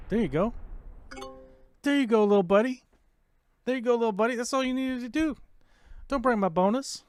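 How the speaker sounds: high, loud patronizing coo; mock baby talk. Gamer to rival attacking another player, high mock-encouraging coo. Cooing at opponent like a pet.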